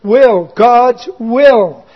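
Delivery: "will, God's will" stretched slow; slow down and lean on "will"; drawn-out "will"; stressed will. Shown as a demonstration. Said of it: "The key phrase here is".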